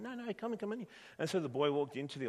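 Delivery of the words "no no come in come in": high, coaxing shopkeeper; shop owner voice. Acted. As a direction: wave him in, pitched up. Shop owner reassures frightened boy.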